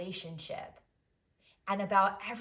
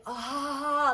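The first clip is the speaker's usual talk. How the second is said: blissful sigh; 6 semitones higher, 6 dB louder than usual. Retold or imitated. Relief after feeling Biddy inside.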